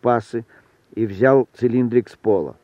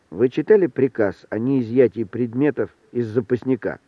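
ordinary delivery, nothing marked

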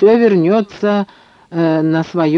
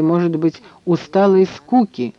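usual speaking voice

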